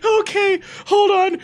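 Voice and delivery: high, helpless laughing voice; crying with laughter. Real, not acted. Streamer can't read the last line, crying laughing. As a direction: squeeze the words out through real laughter. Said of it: "I'm fucking crying".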